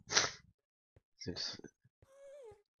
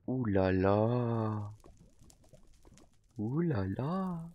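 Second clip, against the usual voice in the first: drawn-out amazement; much slower than usual. Real, not acted. Admiring diamond armour.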